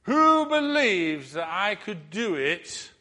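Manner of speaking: slow build to a high loud blindfolded; showman challenge. Retold or imitated. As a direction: tease the crowd, rising. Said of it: "he says".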